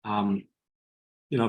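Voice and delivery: usual speaking voice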